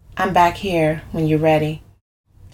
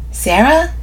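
higher, rising "Sarah?"; questioning echo; 8 semitones higher, 4 dB louder than usual. A narrator's reading. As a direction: repeat the name as a puzzled question. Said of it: "I repeat".

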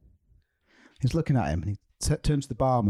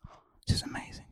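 awed whisper; 10 dB quieter than usual. Acted. Joke guy marvels at his fortune.

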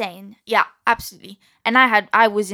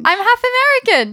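high, loud insistence; insist, pitched right up; emphatic correction; 13 semitones higher, 5 dB louder, much faster than usual. Real, not acted. Insisting to astonished host.

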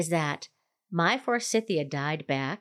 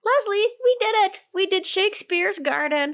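high, loud triumphant cheer; excited celebration; 12 semitones higher, 4 dB louder than usual. Real, not acted. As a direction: celebrate finishing the show. Celebrating finish.

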